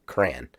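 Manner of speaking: CRAYON squashed to one syllable, cran; can with R. Shown as a demonstration. Explaining his problem word, low one-syllable cran. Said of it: "Like a can with an R".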